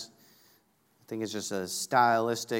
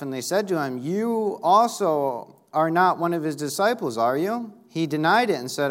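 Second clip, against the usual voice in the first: raised, louder pulpit reading voice; projected, higher-pitched dramatic Bible reading; forceful scripture reading; 5 semitones higher, 6 dB louder than usual. Acted. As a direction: read the Bible passage out emphatically to a congregation. Reads scripture aloud with force.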